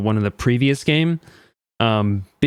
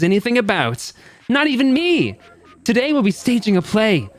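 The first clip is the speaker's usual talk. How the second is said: theatrical show host; 8 semitones higher than usual. Acted. Host introduces today's star Boy A to the audience, theatrical. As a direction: welcome the audience with showy pomp.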